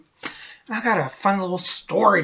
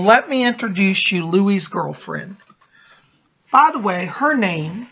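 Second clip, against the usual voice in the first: ordinary talk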